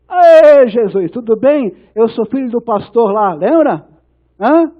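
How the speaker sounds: mock-familiar greeting, opening high and loud; chummy newcomer in heaven. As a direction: hail Jesus like an old acquaintance. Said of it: "vai chegar no céu e vai dizer". (imitando um conhecido folgado no céu)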